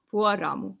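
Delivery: usual speaking voice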